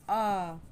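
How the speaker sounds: "अ" stretched into a long aah; drawn-out "अ"; long A sound. Shown as a demonstration. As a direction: hold the vowel "अ" for learners. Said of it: "long sound".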